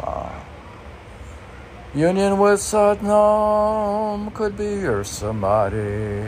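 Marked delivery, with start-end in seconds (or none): 1.9-6.2 much higher, louder, slow intoned line; reading a sacred phrase with reverence; solemn quote reading. Shown as a demonstration. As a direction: intone it slowly like a mantra. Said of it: "reading a quoted line aloud".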